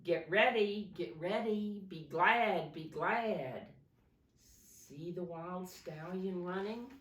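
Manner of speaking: put-on dramatic read-aloud for kids; storybook reading. A narrator's reading. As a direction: read the Christmas story to little ones with energy. Reading picture book to children.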